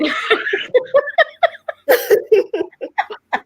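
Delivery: long high laugh; high burst of laughter. Real, not acted. Laughs at the joke.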